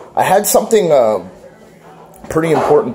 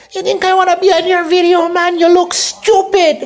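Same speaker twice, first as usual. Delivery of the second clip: imitating partner. Voicing partner's thoughts about his video, mocking imitation. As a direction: put on a squeaky caricature of a fed-up partner. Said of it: "That's what's going on in her mind".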